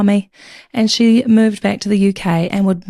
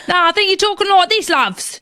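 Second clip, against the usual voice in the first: higher, quick mock Geordie accent; 7 semitones higher than usual. Host teasing Geordie listeners in a mock Geordie accent. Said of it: "Let's hope there's no Geordie people".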